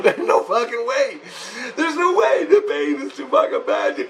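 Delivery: very high, strained mock wail; acted agonized protest. Patient protests inside machine as pain peaks, acted agonized wail.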